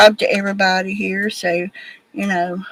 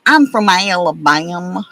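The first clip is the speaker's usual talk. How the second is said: hammed-up Southern accent. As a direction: lay on the Southern accent for show. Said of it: "They loved me when I did that".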